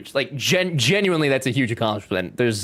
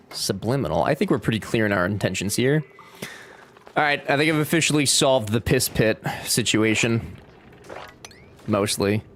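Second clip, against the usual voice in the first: ordinary delivery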